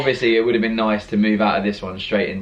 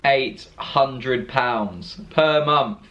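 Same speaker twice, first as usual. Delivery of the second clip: very slow, weighty figure; slow emphatic; much slower than usual. Real, not acted. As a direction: spell out the shock slowly. Stressing the rent rise.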